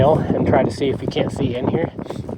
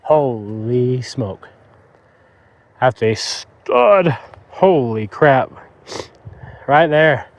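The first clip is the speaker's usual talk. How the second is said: slow, stunned disbelief; amazed; 6 dB louder, much slower than usual. Real, not acted. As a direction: awestruck at the find. Finding a big buck's antler.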